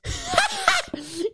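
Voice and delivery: high-pitched cackling laugh; demonstrative laugh. Shown as a demonstration. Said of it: "That's what I look like when I'm".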